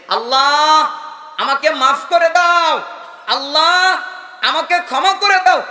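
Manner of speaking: acted high, loud supplication; loud pleading prayer. Acted plea to God for forgiveness.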